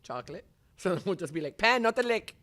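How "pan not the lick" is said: high, loud call to a pet; dog scolding. Acted. Owner calling Pan off licking, high loud scolding. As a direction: holler the name and command.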